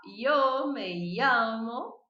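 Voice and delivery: slow and drawn out, llamo as yamo; careful slow demo; llamo with j sound. Shown as a demonstration. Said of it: "Você pode tentar falar".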